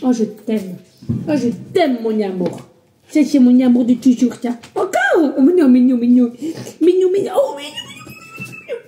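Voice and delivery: squealing cutesy coo. Real, not acted. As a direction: gush over the puppy. Cooing over her dog.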